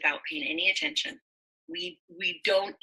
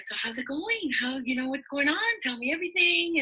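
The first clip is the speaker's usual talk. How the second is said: higher-pitched, re-enacted friendly questioning; eager catch-up; 7 semitones higher than usual. Reconnecting with a good friend over lunch, eagerly curious. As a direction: invite the gossip, pitch climbing.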